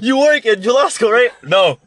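high loud customer then shouted no; mock reenactment. Friend reenacting the butcher mixup, high and loud. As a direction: act out both sides loudly.

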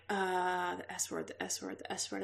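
whispers the S word, the S word to herself; whispered to herself. Retold or imitated. Mom puzzling over which word she said, whispered. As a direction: murmur it over and over under the breath. Mom quoted, searching her memory.

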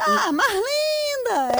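high, slow, drawn-out mock squeal of the name; imitating her little shriek. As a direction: stretch the "Ah" way up like her signature cry. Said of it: "É aquele gritinho que ela dá".

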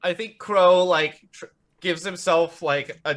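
ordinary talk

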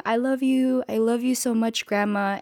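put-on high, tender little girl's voice; tender child. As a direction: say it like a small child adoring her grandma. Child voiced telling grandma love.

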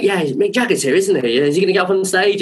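high-pitched calling to the crowd; imitated stage patter. Bandmate on stage announcing [name], excited patter.

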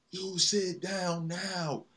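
put-on high, slow bossy kid voice; little girl playing stern dad; child imitating dad. Daughter mimics dad's order.

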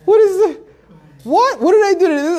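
high, loud, alarmed inner voice; frantic rising worry, blurting each question; asking himself what he did, in a panic; panicked thoughts. Retold or imitated. Nervous thoughts beside the skinhead.

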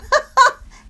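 loud high burst of laughter. Real, not acted. Jokes about shutting up.

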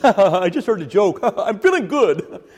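loud, high, laughing through "I'm feeling good"; mock giddy happiness. Preacher mocking shallow happiness as a joke-fuelled giggle. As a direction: play shallow giggly cheer. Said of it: "happy doesn't mean".

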